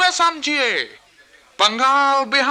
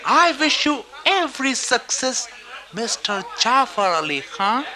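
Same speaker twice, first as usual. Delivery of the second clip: slow flattery; slower than usual. Acted. Flattering the plotter.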